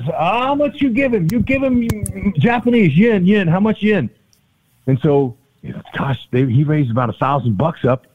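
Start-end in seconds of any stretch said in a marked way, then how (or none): 0.0-4.1 high, drawn-out pleading questions; mock haggling. Teammate taking bets on worm eating, mock haggling. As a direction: imitate an eager dealer pitching upward, slowly.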